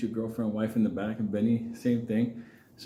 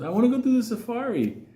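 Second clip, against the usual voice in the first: put-on high, bright-eyed girl voice, pitched up; girl's eager request; 10 semitones higher than usual. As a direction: play the excited girlfriend asking. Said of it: "she goes".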